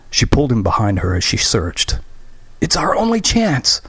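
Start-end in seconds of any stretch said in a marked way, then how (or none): 2.6-3.8 high, urgent cry; desperate shout. Acted. Urging while running.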